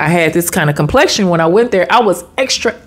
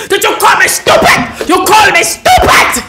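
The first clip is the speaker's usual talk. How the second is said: very high, spluttering mock fury; imitation of an irate official losing it; retold spitting rage; 12 semitones higher than usual. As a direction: spit the accusation out, hammed up. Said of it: "And he's spitting".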